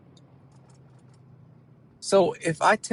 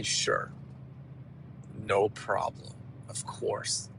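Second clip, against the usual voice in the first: barely voiced, soft and small; meek whisper; 6 semitones lower, 11 dB quieter, slower than usual. Whispering a meek yes to a request. Mouth agrees while body refuses.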